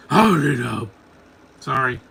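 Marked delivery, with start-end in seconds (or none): 0.1-0.9 loud, throaty mock growl; Batman. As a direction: roar it in a parody gruff-hero rasp. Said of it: "that voice is terrible".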